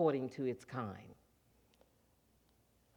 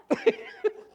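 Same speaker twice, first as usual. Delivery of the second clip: high laugh; 7 dB louder than usual. Real, not acted. Laugh after the punchline.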